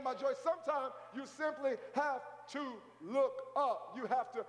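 high, driving preacher's delivery; fervent preaching. Real, not acted. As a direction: preach it hard to the congregation. Preaches at full force.

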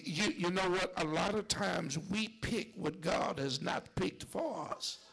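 ordinary talk, nothing marked

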